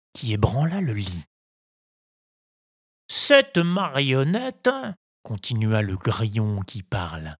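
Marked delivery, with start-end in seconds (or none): 3.1-4.7 slow, lightly raised scolding; stern cricket. A narrator's reading. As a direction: drag it out, scolding. (voix de grillon sévère)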